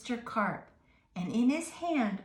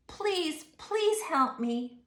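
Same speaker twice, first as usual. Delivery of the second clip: pleading; 7 semitones higher, slower than usual. Acted. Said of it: "I said".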